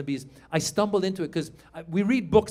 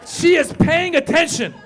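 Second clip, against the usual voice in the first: high, loud mock intensity; exaggerated high-pitched declaration; intense alertness; 10 semitones higher, 7 dB louder than usual. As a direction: shout it high, hammed-up. Acting out the sharp, alert daughter.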